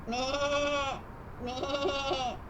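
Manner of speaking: quavering "baa" imitation; drawn-out wobbly sheep call; sheep bleat.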